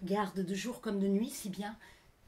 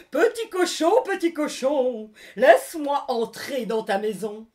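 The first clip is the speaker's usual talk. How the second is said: loud, slow wolf call; wolf calling at the pig's door; menacing wolf; 3 semitones higher, 13 dB louder than usual. Acted. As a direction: coax the pig sweetly but threateningly. Wolf calls to the pig.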